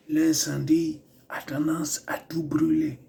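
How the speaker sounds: slow, whispered fake proverb in a put-on African accent; mock African proverb. Hero warning of the fire with a made-up proverb, slow mock accent. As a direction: intone it slowly like solemn wisdom in a mock accent. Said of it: "j'essaie de faire des proverbes, euh, africains".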